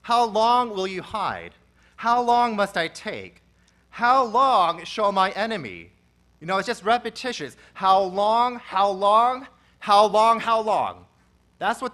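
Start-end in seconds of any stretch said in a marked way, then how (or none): 0.0-5.9 loud, slow, pleading cry to God; loud drawn-out cry of despair. Acted. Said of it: "The psalmist cries out". 7.7-11.1 loud repeated pleading cry; loud insistent lament. Acted. Preacher repeats the psalmist's cry.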